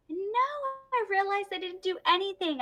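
sudden high-pitched realization; surprised realization. Real, not acted.

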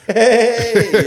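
high, loud drawn-out hey; gleeful mimicking call. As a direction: burst out in giggly mockery.

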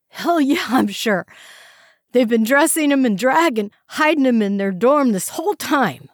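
high, loud, emphatic insisting; desperate insistence. A narrator's reading. Prisoner insisting to officers, high and loud. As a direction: insist hard to be believed.

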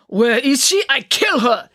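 put-on thick Russian accent, loud and high; Russian-accented yell. A narrator's reading. Coach storming in after the girl, yelling in Russian accent. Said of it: "yelled Mister Popov in his thick Russian accent".

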